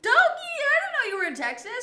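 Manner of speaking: loud, high, delighted call-out; a loud, high, thrilled hello; excited greeting. Real, not acted. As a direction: greet him like a surprise guest. Surprised greeting of a chat viewer.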